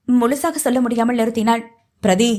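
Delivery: ordinary delivery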